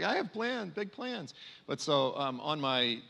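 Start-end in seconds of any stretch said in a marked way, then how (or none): 0.0-1.3 much higher, teasing echo of the TV guy; mock bro voice. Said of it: "he goes".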